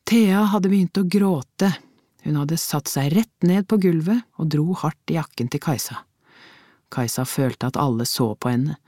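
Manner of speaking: ordinary delivery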